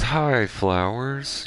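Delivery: high, drawn-out cartoon greeting; SpongeBob. SpongeBob greeting the flowers he breathes on, cartoon voice. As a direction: stretch it out in a high, innocent singsong. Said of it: "he breathes on flowers, he says".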